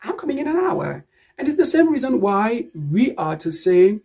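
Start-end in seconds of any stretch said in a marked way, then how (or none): 0.0-1.1 "an hour" joined smoothly as "anour"; assimilated article. Shown as a demonstration. Teacher giving the right version, "an hour" linked as one word. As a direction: carry the N of "an" into "hour". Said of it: "it can easily be assimilated".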